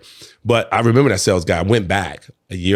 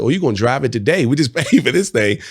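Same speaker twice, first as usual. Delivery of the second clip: laughing reply; 6 semitones higher, 5 dB louder than usual. Retold or imitated. His own reply, laughing.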